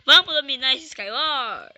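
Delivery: loud pumped-up call; rally the crowd; hyped shout. Real, not acted. Hyping up the audience.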